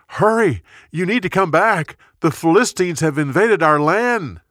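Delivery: a breathless messenger, pressing the king to hurry; urgent warning. Acted. Messenger rushes to warn Saul.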